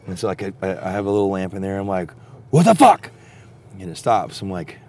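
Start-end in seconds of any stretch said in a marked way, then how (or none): 2.5-3.1 loud, high shout at the intruder; retold startled holler; startled yell. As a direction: yell out as if woken by scraping. Startled camper yells at noise outside.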